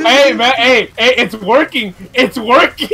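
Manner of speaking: high, loud excited calls; mock excited yelling. Joining the friend's water bit, mock excited. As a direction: yell it like a hammed-up alarm.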